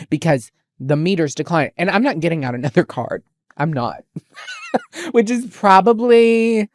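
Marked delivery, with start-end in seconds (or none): 4.1-5.1 a sudden, very high squeal of laughter; squealing laugh at herself. Real, not acted. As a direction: let out a high-pitched shriek of a laugh. Laughs at her own stubbornness.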